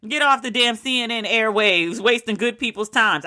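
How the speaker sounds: ordinary delivery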